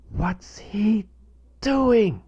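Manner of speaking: breathy high whispered question; wondering whisper. Retold or imitated. Father wondering at his son, whispered. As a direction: whisper it, puzzled and curious. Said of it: "I wondered".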